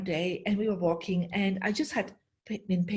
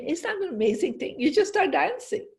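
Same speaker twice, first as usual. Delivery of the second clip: excited; 7 semitones higher than usual. Real, not acted. Excited jump in pitch.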